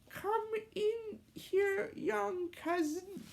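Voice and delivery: put-on high, slow child voice; kid voice. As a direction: invite them in as a little boy. Ross kid voice.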